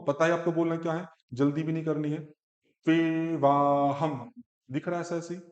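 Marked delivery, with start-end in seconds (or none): 2.9-4.3 तेवाहम drawn out very slowly, syllable by syllable. Shown as a demonstration. Teacher telling student not to rush तेवाहम, said slowly. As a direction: stretch तेवाहम out slowly, no hurry. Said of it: "धीरे धीरे करके बोलो".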